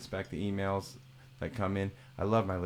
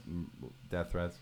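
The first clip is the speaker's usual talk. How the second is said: ordinary talk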